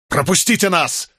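high, forceful shout; barked order. A narrator's reading. Young man to soldiers blocking the scaffold, barked shout. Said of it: "рявкнул Николас".

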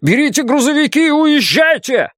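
high shouted evacuation order; urgent, high-pitched military shout; colonel's shout. A narrator's reading. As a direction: yell orders across a camp under fire. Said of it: "крикнул полковник Магоро".

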